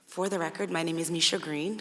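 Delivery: ordinary talk, nothing marked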